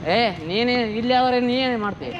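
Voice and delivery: loud, drawn-out angry challenge. Real, not acted. Rider challenges the other man in a quarrel, angry. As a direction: confront them loudly.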